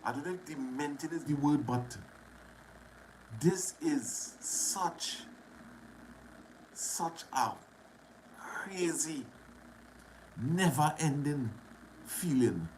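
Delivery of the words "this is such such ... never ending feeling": holding back tears. Real, not acted. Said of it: "I try not to cry".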